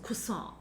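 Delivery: careful stab at French croissant, R softened to a w; "croissant" as kwuh-sohn, end left nasal; French pronunciation. Shown as a demonstration. Groping for the French sound, tentative French pronunciation of croissant. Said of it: "almost like a croiss".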